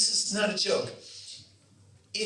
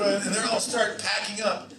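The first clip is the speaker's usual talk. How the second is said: ordinary talk, nothing marked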